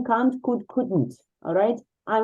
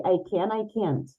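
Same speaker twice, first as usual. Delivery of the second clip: American accent, "can't" with a flat a like "can"; American accent, "I can, I can't" almost the same. Shown as a demonstration. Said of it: "But Americans would say".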